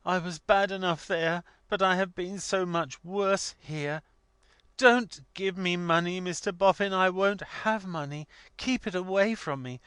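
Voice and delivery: put-on high-pitched, distraught young woman pleading; acted weeping outburst, high and imploring; passionate tearful cry. A narrator's reading. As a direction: beg desperately through tears, voice pitched right up. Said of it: "incoherent passion of tears".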